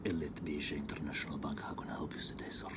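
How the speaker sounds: whispery supposed supervisor voice; same guy faking a supervisor in a breathy hush; whispered supervisor. Acted. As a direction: whisper as a new agent pretending to be someone else.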